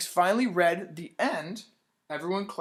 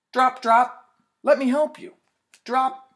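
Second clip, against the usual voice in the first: put-on loud, high cheerful kid voice; loud happy kid calls; excited drops; 7 semitones higher, 10 dB louder than usual. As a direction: call it out bright and happy. Drops and kids helping.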